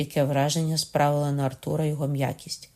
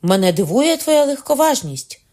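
much higher and louder, outraged; indignant exclamation; 11 semitones higher, 9 dB louder than usual. A narrator's reading. Shipowner rebukes Arthur's levity, loudly indignant. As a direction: rebuke him in a high, ringing shout. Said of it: "вигукнув судновласник, з гідністю підводячись з місця".

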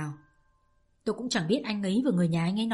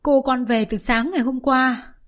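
crying nurse; 3 semitones higher, 5 dB louder than usual. Acted. Old nurse sobbing to the returning lover. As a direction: wail it out through tears. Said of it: "chạy lại ôm tôi khóc và bảo".

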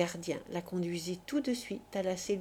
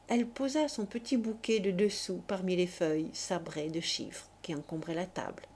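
ordinary delivery

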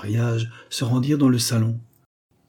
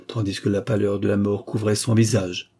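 ordinary delivery

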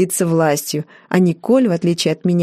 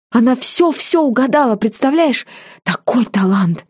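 starry-eyed gushing; 5 semitones higher than usual. A narrator's reading. Джорджина gushes over fortune teller.

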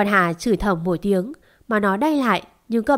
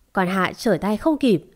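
ordinary delivery

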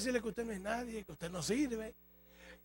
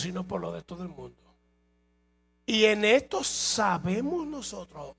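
loud, slow, drawn-out proclamation; forceful scripture reading; 9 dB louder, slower than usual. Acted. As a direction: preach the verse word by word. Reads scripture aloud at full force. (lectura bíblica proclamada con fuerza)

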